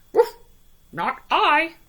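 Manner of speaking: high yappy barks then a loud refusal; a little dog saying no. A narrator's reading. Dog refusing the hen, loud yappy. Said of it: "said the dog".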